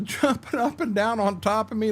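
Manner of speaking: ordinary talk, nothing marked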